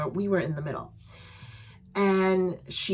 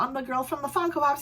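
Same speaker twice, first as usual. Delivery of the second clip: ordinary talk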